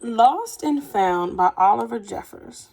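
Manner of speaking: put-on unhurried children's story narration with gentle emphasis; reading to children. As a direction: read it like a picture book to kids, letting the pauses land. Teacher reads picture book aloud.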